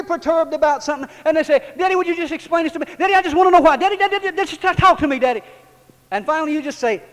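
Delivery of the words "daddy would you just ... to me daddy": put-on high, fast whiny kid begging Daddy; mock child whining for an answer; pestering child voice. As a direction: play the nagging little kid tugging at a parent. Preacher voicing pestering child.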